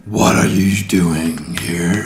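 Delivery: slow whispered alien question. Acted. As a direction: hiss the question from the dark. Alien master interrogates.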